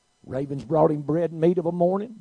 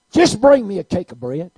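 insisting; 5 semitones higher, 11 dB louder than usual. Acted. Elijah repeats louder.

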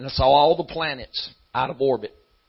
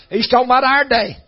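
high, loud preaching climax; full-force preaching; 10 semitones higher, 9 dB louder than usual. Acted. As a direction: build to a shouting sermon peak.

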